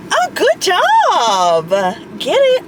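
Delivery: high, delighted praise for a driver; bright encouraging cry from the passenger seat; excited cheering. Real, not acted. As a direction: cheer her on as she pulls in. Cheering on Ava driving.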